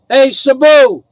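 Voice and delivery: loud, high call to the dog; shouting the dog's name. Real, not acted. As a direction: call the dog from across the yard. Calling the dog.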